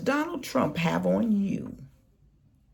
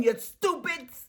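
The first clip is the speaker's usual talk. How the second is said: normal talking voice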